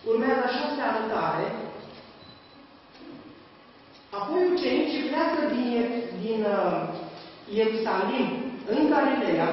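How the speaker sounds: loud, high-pitched emphatic sermon; fervent preaching. Acted. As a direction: proclaim each point to the congregation. Preaching at full force.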